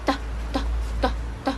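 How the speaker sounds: "T, t" breathed, tongue behind the teeth; soft voiceless "t" taps behind the teeth; British-style "t" whispered; whispered t sound. Shown as a demonstration. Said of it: "we pronounce it at, behind our teeth".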